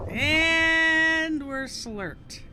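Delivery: very slow, loud and high; excited. Real, not acted. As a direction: stretch it out, thrilled. Swallowed by the manatee.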